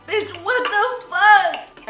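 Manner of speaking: slow, high, loud disbelief; amazed outburst. Real, not acted. Seeing boyfriend's garbage plate, thrilled disbelief. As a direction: drag it out in delighted shock.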